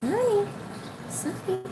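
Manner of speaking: high, soft pet-talk hello; cooing greeting. Real, not acted. Owner saying hi to coax the bird, cooing.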